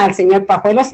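ordinary delivery

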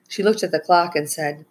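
ordinary delivery

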